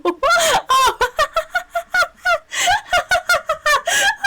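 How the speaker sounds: squealing high laughter; high helpless laugh. Real, not acted. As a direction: laugh hard, pitch way up. Laughing at own game death.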